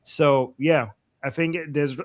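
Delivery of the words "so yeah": ordinary talk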